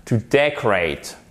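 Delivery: slow "decorate", stress on DEC; stress on first syllable. Shown as a demonstration. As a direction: lean on DEC, drop the rest. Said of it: "accent tonique sur la première syllabe". (« decorate » lent, accent tonique sur DEC)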